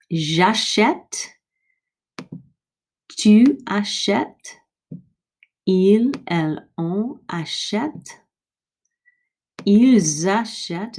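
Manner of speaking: j'achète with open eh, slow and careful; model conjugation. Shown as a demonstration. Teacher to class, slow careful model. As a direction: drill each form slowly.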